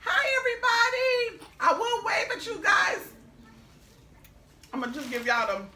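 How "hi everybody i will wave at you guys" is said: high, loud, shouted hello; excited greeting. Real, not acted. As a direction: call out hello to everyone watching. Greeting viewers loudly.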